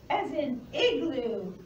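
usual speaking voice

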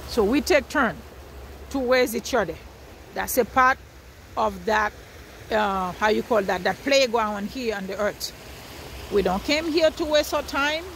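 usual speaking voice

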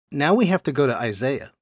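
ordinary delivery

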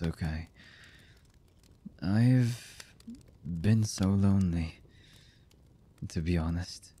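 slow, halting lover's voice with long pauses; hesitant, emotional confession. Acted. Lover confessing horrible deeds and love to the beloved, halting and emotional. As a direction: confess haltingly, one fragment at a time. Voice actor's romantic audio roleplay.

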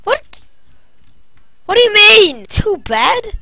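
high, loud disbelief; indignant surprise. Real, not acted. Streamer baffled by the game's verdict, indignant. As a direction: react in outraged surprise.

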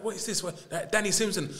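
mock bewildered "what is this"; retold confusion. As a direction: act puzzled at the news. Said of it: "I'm like".

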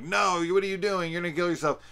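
acted alarmed yell, pitched high; mock yelling. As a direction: yell it as an imagined horrified onlooker. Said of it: "instead of Herbert yelling out".

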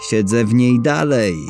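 usual speaking voice

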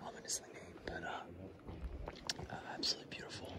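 hushed awed cave whisper. Real, not acted. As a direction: whisper softly, full of wonder.

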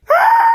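sudden high-pitched shriek of fright; shrill scream. Acted. Startled dad sees the drain, screaming high. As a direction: scream like the drain just jumped at you.

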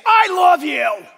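slow, loud barked "I love you" that sounds harsh; wrong tone. Shown as a demonstration. Husband saying I love you in the wrong tone, loud slow bark. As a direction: yell "I love you" so it warms nobody. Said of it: "the words are right, the tone is wrong".

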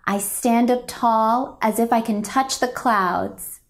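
ordinary delivery

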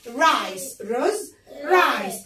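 normal talking voice

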